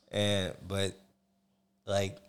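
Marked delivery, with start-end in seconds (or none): none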